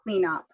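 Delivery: "clean up" as one unit, "clea-nup"; linked words. Shown as a demonstration. Teacher repeating the linking example, words run together. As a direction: glide from "clean" straight into "up". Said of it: "I don't stop here".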